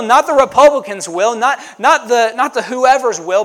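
loud, high, ringing preacher cry; preaching at full force. Acted. Pastor preaching the Lord's Prayer to the congregation, fervent and loud. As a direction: build the sermon to a fervent peak.

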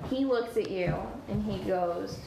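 usual speaking voice

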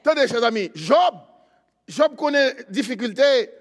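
high, loud sermon delivery; full-force preaching. Acted. Preacher telling the church not to fear, full-force preaching.

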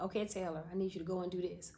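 put-on soft, quick, calm instruction to a child; gentle calm voice. Shown as a demonstration. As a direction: speak gently so she listens. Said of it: "I had to talk to her a certain way".

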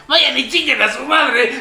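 imitating a drunk friend's slow defiant yell; mock yell. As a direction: hurl it at the dad, imitated. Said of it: "Pero no lo grité".

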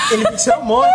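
high laughing shout; excited shout. Real, not acted. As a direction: burst out with it. Excited retelling of resurrection. (grito empolgado rindo)

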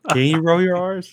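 high, loud surprised question; amazed he can do it. Real, not acted. Surprised question about rolling R's.

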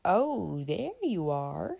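put-on slow, swooping drunken drawl; leering drunk, vowels stretched; drunken man. A narrator's reading. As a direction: slur it out, sliding up and down. Drunkard accosts the girl.

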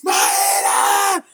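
high shouted name, squealing fan; fan scream. Retold or imitated. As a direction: shriek her name like a starstruck fan. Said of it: "quando eu vi a Maíra". (grito de fã, agudo e alto)

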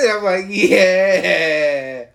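slow words through laughter; laughing. Retold or imitated. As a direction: stretch it out while laughing. Retold quote while laughing.

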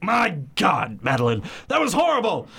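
high, frantic husband voice; panicked Ernest. Acted. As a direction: squeal it out in horror. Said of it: "I'm gonna have you read for Ernest".

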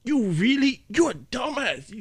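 high, slow exasperated scolding; scolding boss. Retold or imitated. Boss scolding the bouncer, high exasperated. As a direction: berate the new guy.

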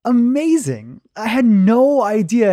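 high, amazed exclamation. Real, not acted. Host amazed by the guest's story, high and excited. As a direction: gush in real amazement.

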